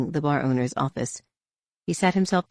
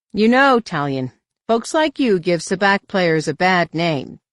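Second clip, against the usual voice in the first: gloating; 4 semitones higher, 6 dB louder, slower than usual. A narrator's reading. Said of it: "couldn't resist taking the opportunity to gloat".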